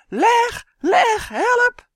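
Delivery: high, very loud desperate yells; boy screaming for his pet to come; screaming as loud as he can. A narrator's reading. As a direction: scream for help with everything. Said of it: "schreeuwde Justin, nu zo hard als hij kon".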